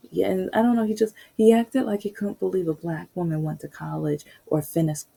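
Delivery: normal talking voice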